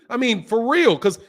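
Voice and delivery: usual speaking voice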